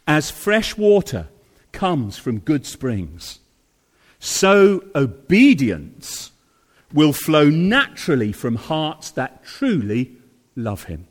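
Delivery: slow measured preaching. Acted.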